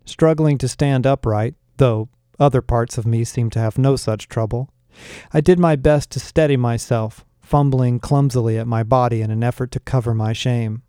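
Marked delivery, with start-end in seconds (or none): none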